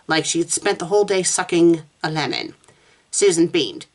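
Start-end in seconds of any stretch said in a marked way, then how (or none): none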